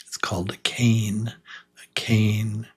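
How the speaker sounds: whispered "cane", unvoiced; soft teaching whisper. Shown as a demonstration. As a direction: hush the word for the learners. Teacher models word for learners.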